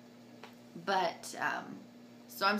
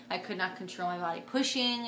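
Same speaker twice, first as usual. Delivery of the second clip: ordinary talk, nothing marked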